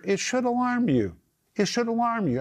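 high-pitched, insistent urging; urgent exhortation. Real, not acted. Urging listeners to be alarmed.

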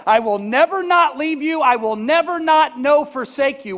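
loud, high "I will never not leave you", "not" hit hard; loud preached promise of Jesus; emphatic proclamation. Shown as a demonstration. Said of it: "Jesus said".

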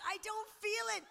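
high, loud imitation of disbelief; retold amazement. Woman finding the pain gone as she walks, retold amazement. Retelling her astonishment at no pain.

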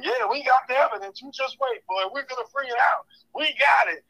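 hammed-up smug politician, high; Ted Cruz. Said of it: "If you fall for Ted Cruz telling you".

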